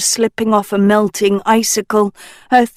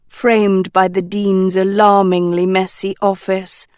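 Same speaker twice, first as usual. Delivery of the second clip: plain reading voice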